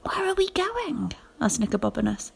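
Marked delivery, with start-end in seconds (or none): 0.0-1.1 slow, breathless secret whisper. A narrator's reading. Nicobobanus whispering to Rosie as they sneak out. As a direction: hush it so no one wakes.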